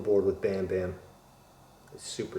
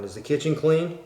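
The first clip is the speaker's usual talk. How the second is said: high, louder mock wife question; wife's text voiced; 6 semitones higher, 5 dB louder than usual. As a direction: imitate a wife checking up on you. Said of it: "There's my wife. Texting me going".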